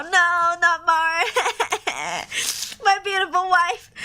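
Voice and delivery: loud tearful cry. Real, not acted. Streamer crying loudly over the dead wife. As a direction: grief spilling over, real. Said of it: "this is so sad".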